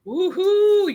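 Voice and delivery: high, loud whoop; excited cheer. Real, not acted. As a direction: let out a big high woohoo. Cheering the formula reveal on air.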